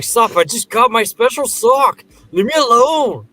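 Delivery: high, loud mock teen whine; whiny protest. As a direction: beg to be left alone, hammed up. Voices boy protesting to sister.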